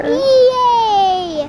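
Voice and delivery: high, loud, drawn-out cheer; excited cheer. Real, not acted. Child cheering at news of the eye doctor, excited. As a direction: squeal it out with delight.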